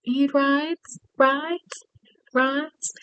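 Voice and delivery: high soft touting voice, fading to a whisper; hawking rides. Retold or imitated. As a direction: sing out the offer like a street hawker. Pedicab driver calling out.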